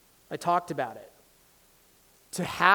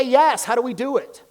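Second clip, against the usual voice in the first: eager yes; 7 semitones higher, 9 dB louder than usual. Shown as a demonstration. Modelled eager yes to student.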